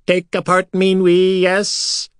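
high, slow, carefree alien voice; blithe creature voice. A narrator's reading. Silver Sand answering the prisoners blithely. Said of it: "the Silver Sand answered blithely".

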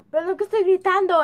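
loud, fast apologetic yell; yelling across the room. Real, not acted. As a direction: shout the apology over the room.

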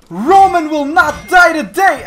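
loud, high, slow shout; hammed-up warrior roar; heroic battle cry. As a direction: declare defiance at the top of the voice.

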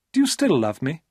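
put-on young woman charming Paul; high, wheedling female voice; Maria's coaxing voice.

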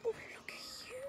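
gasp, then breathy high whisper; hushed awe. Real, not acted. Visitor cooing to a deer up close, hushed awe. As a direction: coo it softly, almost whispered.

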